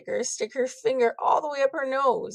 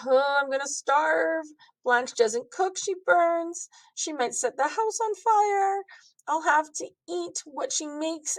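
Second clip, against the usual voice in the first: put-on dramatic kid; 5 semitones higher than usual. Panicked thought bubble.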